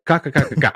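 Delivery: string of staccato "ka" sounds; mocking stutter of k sounds; mimicked syllable run. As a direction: reduce the drink order to repeated "ka". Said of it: "It was like".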